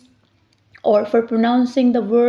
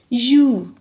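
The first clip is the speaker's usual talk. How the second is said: ordinary talk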